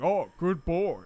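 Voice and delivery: drawn-out, patronizing imitation; mock praise for a dog. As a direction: praise them like you would a pet. Mimicking someone praising a person like dog.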